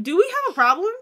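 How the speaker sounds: mock confrontation; confrontational. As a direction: square up to him. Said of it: "I was like".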